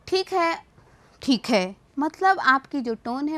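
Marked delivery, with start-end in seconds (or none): 0.1-0.6 ठीक है pitched high and a little louder; high-toned ठीक है. Shown as a demonstration. Teacher showing how tone changes a word, ठीक है said high. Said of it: "इस शब्द को कैसे बोल रहे हैं". 1.2-1.8 ठीक है dropped low, loud and firm; low-toned ठीक है. Shown as a demonstration. Teacher showing how tone changes a word, ठीक है said low and loud. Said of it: "इस शब्द को कैसे बोल रहे हैं".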